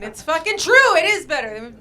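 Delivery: emphatic insistence. Acted. Insisting heroin is better.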